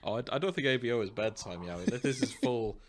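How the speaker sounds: usual speaking voice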